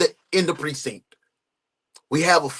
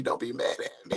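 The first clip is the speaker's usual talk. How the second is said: whispered plea; 5 semitones lower, 13 dB quieter than usual. Acted. Streamer whispering to his girlfriend Kelly, pleading.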